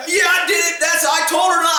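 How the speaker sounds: high, mock indignant retort; defiant husband. Husband defiantly confesses, retold.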